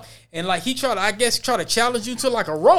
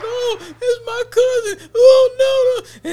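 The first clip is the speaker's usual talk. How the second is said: put-on high, loud, slow crying wail of a girl; distraught girl crying; 7 dB louder, much slower than usual. Retelling the girl in the hallway, high crying wail. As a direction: wail it like a girl losing it over her cousin. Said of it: "she was so fucking distraught".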